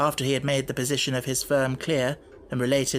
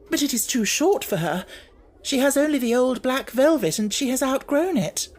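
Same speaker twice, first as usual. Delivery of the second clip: much higher, nervous sister voice; timid sister; 10 semitones higher, 4 dB louder than usual. Acted. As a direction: answer meekly and anxiously.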